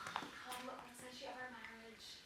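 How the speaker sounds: hushed repeat. Acted. Juliet asks again, hushed.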